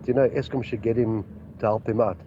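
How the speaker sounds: ordinary talk, nothing marked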